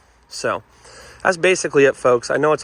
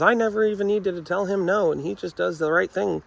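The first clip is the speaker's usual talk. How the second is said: higher, light imitation of a sweet grandma; mock grandma voice; 7 semitones higher than usual. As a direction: lightly play a doting grandma, a bit higher. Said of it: "your grandma's dog that goes".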